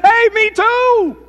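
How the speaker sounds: very high, loud, slow gushing; gleeful rescuer. Acted. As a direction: stretch out the joy. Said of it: "He said".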